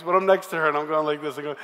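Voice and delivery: normal talking voice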